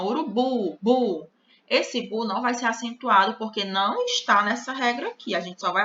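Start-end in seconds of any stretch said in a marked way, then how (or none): 0.0-1.3 "urubu" with a firm, stressed "bu". Shown as a demonstration. Teacher to pupils, isolating the strong syllable "bu". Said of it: "a sílaba forte é a última".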